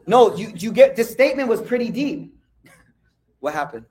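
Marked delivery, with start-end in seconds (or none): 0.1-2.3 loud. Real, not acted. Preacher cuts back in.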